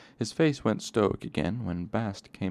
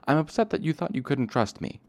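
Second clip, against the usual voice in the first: spluttering upset; 8 semitones higher, 4 dB louder than usual. Acted. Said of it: "Bast spluttered".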